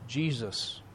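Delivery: ordinary talk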